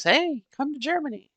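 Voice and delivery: high, cheery mock invitation; lightly voiced German guy; imitating German friend. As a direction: play his friendly call, pitched well up. Imagines German man inviting her.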